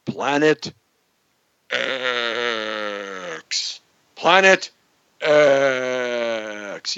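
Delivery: drawn-out low X, mock ominous; deep voice. Shown as a demonstration. Host introduces the doomsday planet in a deep voice. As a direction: intone it deep and stretch the X. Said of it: "say it with a deep voice".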